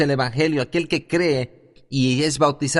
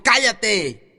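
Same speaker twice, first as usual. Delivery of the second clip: sharp retold command, higher pitched; mock shout; 7 semitones higher than usual. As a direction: snap the old man into silence as the evangelist. Evangelist silencing shouting man.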